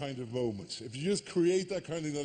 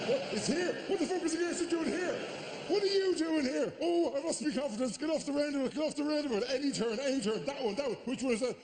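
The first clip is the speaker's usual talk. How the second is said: high, frantic panic at the wheel; mock panic of a lost tourist driver; panicking driver; 9 semitones higher, faster than usual. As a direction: yelp it like you can't find the gear stick.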